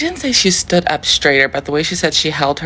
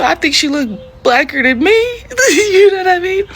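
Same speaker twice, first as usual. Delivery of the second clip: laughing while talking; 14 semitones higher than usual. Real, not acted. Vlogger cracking up at her own comparison, laughing. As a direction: crack up mid-sentence, pitch climbing high.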